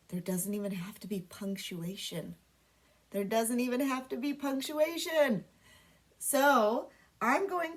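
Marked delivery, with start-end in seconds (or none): 0.1-2.3 secret whisper to the class. Shown as a demonstration. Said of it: "And get this, guys".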